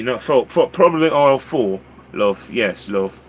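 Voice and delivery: helpful, chatty put-on British accent. Shown as a demonstration. Walmart worker directing a customer to aisles, British accent. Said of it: "I'd switch into the British accent".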